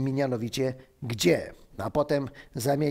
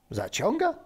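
higher, rising astonished echo; zdumione pytanie; 6 semitones higher than usual. Acted. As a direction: repeat it in rising disbelief. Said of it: "zdumionym wzrokiem". (zdumione, wysokie pytanie)

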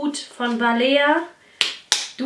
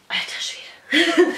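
whisper; 4 semitones higher, slower than usual. Real, not acted. Struggling with heavily taped parcel.

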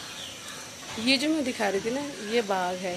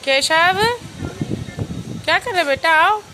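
calling out; 9 semitones higher, 7 dB louder than usual. Real, not acted. Mother calling her son Keshav back, loud call.

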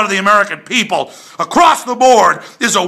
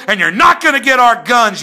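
usual speaking voice

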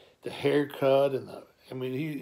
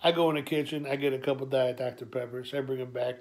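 ordinary delivery

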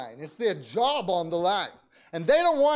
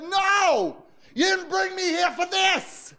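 fervent prayer shout; 9 semitones higher than usual. Retold or imitated. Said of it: "and I prayed, and I said".

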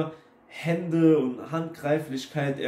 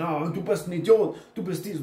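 mock insulting shout; 3 semitones higher than usual. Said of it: "du wirst dann immer lauter und schreist dann".

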